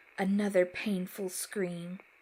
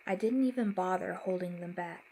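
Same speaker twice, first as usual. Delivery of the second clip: plain reading voice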